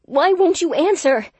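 high, loud desperate shout; frantic yell. Acted. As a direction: yell in panic at the phone. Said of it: "I yelled at the phone".

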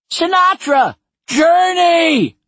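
high loud shouted names; rowdy shout. Acted. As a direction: bark out the names like a heckler.

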